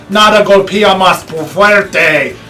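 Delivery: slow, relished Spanish slogan. Shown as a demonstration. Presenter showing off the hammer's slogan, in slow Spanish. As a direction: savor each Spanish word, drawn out slowly. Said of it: "sounds better in the Spanish".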